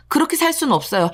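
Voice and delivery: plain reading voice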